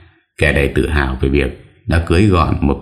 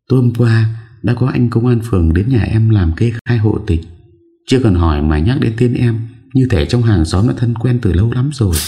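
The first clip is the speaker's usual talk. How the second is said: put-on young woman voice; 6 semitones higher than usual. A narrator's reading. Young woman character speaks.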